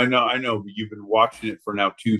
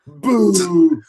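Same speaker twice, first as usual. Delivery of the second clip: loud boo; 6 semitones higher, 6 dB louder than usual. Acted. Calling crowd to boo.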